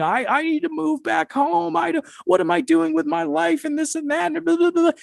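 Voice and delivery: very high, whiny imitation of crying; mock crying. Girl panicking on a drug trip, mock crying. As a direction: mimic a panicking girl in falsetto. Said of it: "She starts crying".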